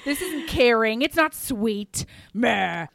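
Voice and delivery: loud gruff insisting he is angry, not worried; a proud Highlander hiding his worry behind fake anger; mock angry Highlander. Highlander denies worry, insists he's angry, loud mock anger. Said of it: "he's a Scottish Highlander".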